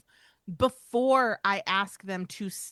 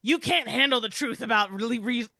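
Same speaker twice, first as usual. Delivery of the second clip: movie quote parody; 2 semitones higher, 3 dB louder than usual. Retold or imitated. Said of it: "Wait, wait, I've got it".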